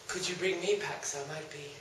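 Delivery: soft, low seductive voice; breathy flirt. Acted. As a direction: coo it seductively. Lady asks sweetly.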